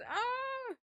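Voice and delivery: sudden high sneeze. Real, not acted. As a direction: let a sneeze burst out. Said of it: "I'm trying not to sneeze".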